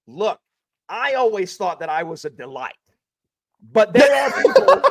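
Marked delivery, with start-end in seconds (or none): 3.7-4.8 laughing. Real, not acted. Joking about people who dislike him.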